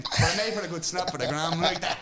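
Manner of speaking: loud laugh through the line, then a burst of laughter. Real, not acted. Fighter laughing about the fine making a good post. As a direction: crack up at your own punchline. Laughing at his own story.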